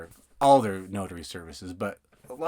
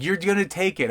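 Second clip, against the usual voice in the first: high, bossy little insistence; mock insistence; 8 semitones higher, 3 dB louder than usual. Escrow officer playfully insisting he take paper. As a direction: playfully order him to take it.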